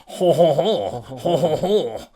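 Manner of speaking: cartoon French ho-ho-ho; mock French laugh. Shown as a demonstration. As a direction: demonstrate French with rolling ho-hos. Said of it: "French is easy".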